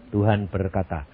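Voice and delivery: ordinary talk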